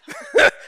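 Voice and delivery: short high burst of laughter; loud amused laugh mid-sermon. Real, not acted. Laughs at his own joke.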